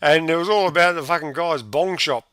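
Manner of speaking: usual speaking voice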